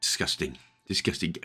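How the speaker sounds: low, quiet mock disgust; disgusted mutter. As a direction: mutter it under your breath in disgust. Coffee stain on the note.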